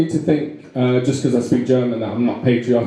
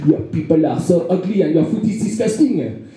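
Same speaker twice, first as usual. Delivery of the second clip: higher, hammed-up French accent; rude French accent; 4 semitones higher than usual. French person insults the British, rude French accent. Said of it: "French people are quite rude to us".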